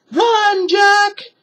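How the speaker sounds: very high, loud warning cry; Annie shouting. A narrator's reading. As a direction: scream a warning to her brother. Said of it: "shouted Annie".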